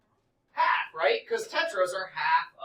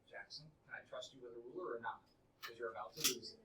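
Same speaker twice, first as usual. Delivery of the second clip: low, soft aside to one student; a hushed, pointed warning; quiet warning; 6 semitones lower, 12 dB quieter, much faster than usual. Real, not acted. Teacher warns a student quietly.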